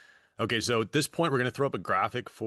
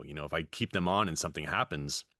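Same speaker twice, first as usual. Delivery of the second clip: ordinary delivery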